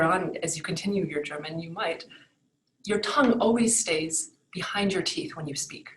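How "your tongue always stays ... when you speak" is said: normal talking voice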